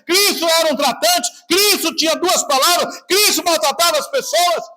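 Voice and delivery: high, loud, rising preacher's rebuke; preaching at full force. Acted. Pastor rebuking the congregation for its sins, preaching at full force. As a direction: hammer each line home like a revival preacher.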